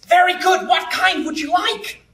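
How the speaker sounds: high-pitched American server; hammed-up American waiting staff; put-on American accent. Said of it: "Did you like my American accent?".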